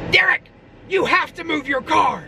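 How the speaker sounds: high, urgent mock janitor voice; stern janitor. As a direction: imitate a pushy janitor barking orders. Janitor bursts in, demanding.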